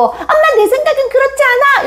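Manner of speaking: put-on very high-pitched child talking back; defiant child. Child objecting to mom's opinion, high defiant child voice. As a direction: pipe up as an indignant little kid. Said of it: "아이들 중에서 눈을 동그랗게 뜨고".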